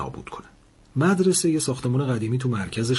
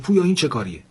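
sharp, higher, louder scold; the teacher snaps at the boy; stern teacher telling off a pupil who threw paper; angry; 6 semitones higher, 5 dB louder, faster than usual. A narrator's reading. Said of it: "بهرام عصبانی شد و گفت".

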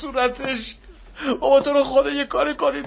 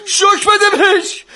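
desperate plea; 6 semitones higher, 7 dB louder than usual. Acted. Father pleading with the doctor to shock her again, desperate. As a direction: beg through breaking voice.

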